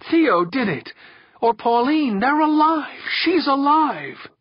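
high, loud cry of joy; overjoyed. A narrator's reading. Victor realising his family is alive, high elated cry. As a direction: build to an elated shout.

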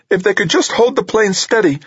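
very high-pitched, strained wish; tense high voice. A narrator's reading. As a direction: say it tensely, pitch pushed right up. Tense operator struggling to lock on.

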